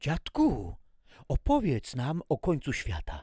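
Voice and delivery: put-on high, eager child's plea. A narrator's reading. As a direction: beg grandpa like a little kid. Dzieci proszą dziadka o opowieść. (dziecięcy głos)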